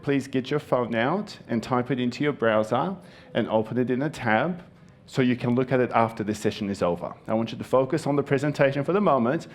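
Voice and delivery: ordinary delivery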